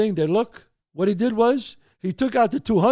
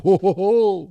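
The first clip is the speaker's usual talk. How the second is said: mock laughter; 3 semitones higher, 4 dB louder, slower than usual.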